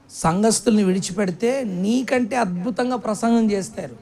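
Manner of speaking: normal talking voice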